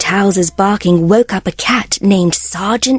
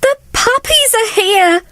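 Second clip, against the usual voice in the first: high, thrilled announcement; excited cry; 13 semitones higher than usual. Acted. Housekeeper crying out that the puppies arrived, high and thrilled. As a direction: burst out with the happy news. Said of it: "Nanny cried".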